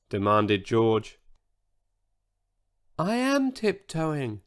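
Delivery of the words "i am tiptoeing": higher, softer, slow little voice; a tiny voice from a giant body, slow and careful. Acted. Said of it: "said Sizo in his tiny voice".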